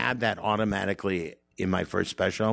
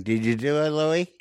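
a hint of a menacing father; drunk dad; 7 semitones higher than usual. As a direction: coax like a half-drunk dad at the banister. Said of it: "he's going".

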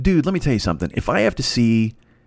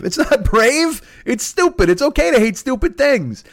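high-pitched, exasperated rant; exasperated rebuttal; 10 semitones higher, slower than usual. Real, not acted. Comedian rebutting fake praise, high exasperated rant. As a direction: burst out in incredulous protest.

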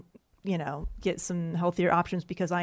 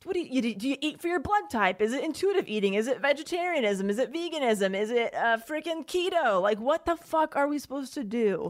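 exasperated; 6 semitones higher than usual. Real, not acted. Exasperated list of diets.

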